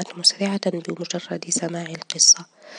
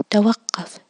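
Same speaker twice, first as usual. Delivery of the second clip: loud, higher cries of stop; repeated desperate yells, louder and higher; tearful shouting; 4 semitones higher, 7 dB louder than usual. A narrator's reading. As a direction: shout him down through tears. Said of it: "والدموع تتطاير من عينيه".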